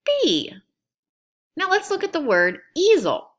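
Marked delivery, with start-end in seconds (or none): 0.0-0.5 put-on bee sung out much higher for kids to echo. Shown as a demonstration. Teacher to young students, modelling bee high and clear. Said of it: "Say it with me".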